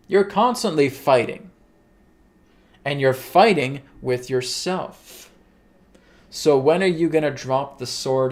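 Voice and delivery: emphatic pronouncements, stretched words and weighty pauses; Adronis, emphatic. Acted. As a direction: proclaim each phrase forcefully, with long pauses. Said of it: "there's an emphatic response from Adronis".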